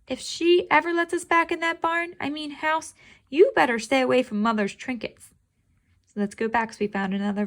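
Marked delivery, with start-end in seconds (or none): 0.1-5.3 squeaky kitten meow, pitched way up. Acted. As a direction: cry it out like a little cat. Reads the story aloud.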